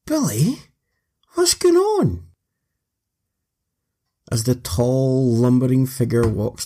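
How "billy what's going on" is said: raised excited voice. Acted. Narrator answers in a raised voice.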